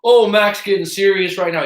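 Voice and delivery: high, loud teasing jeer; imitating the bully flipping it into a joke; mocking taunt. Bully backing off, taunting Max in a high mocking voice. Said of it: "He tried to flip it".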